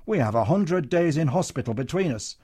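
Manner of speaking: voice rising. Acted. Comedian defending himself to Matron, voice rising. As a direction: pitch climbing as he's cornered. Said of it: "voice had raised several octaves".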